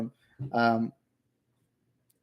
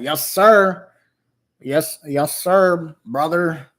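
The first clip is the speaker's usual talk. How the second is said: hammed-up American patriot; 3 semitones higher, 6 dB louder, much slower than usual. Streamer mocking a MAGA hat wearer, hammed-up American voice. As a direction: put on a rowdy patriot voice. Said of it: "that hurts my voice".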